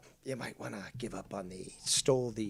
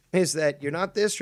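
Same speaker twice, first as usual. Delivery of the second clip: normal talking voice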